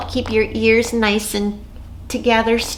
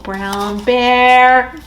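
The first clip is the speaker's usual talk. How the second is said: usual speaking voice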